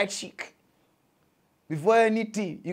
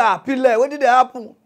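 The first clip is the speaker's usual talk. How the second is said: girl voiced; 6 semitones higher, 9 dB louder than usual.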